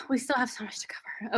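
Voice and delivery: near-whispered note to self; hushed aside. Real, not acted. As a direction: say it under the breath after a sigh. Checking time, muttered aside.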